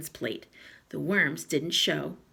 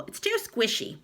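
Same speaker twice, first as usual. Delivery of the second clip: talking through a mouthful; chewing while complaining; mouth full; 7 semitones higher than usual. Acted. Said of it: "talking with his mouth full".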